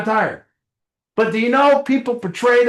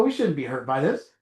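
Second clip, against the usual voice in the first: calm the hurt part down from a deeper place; wise inner voice; 7 semitones lower, 7 dB quieter than usual. Retold or imitated. The older wiser part answers the hurt part, low and soft. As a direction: lower and softer, an older wiser self. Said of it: "wiser parts that go".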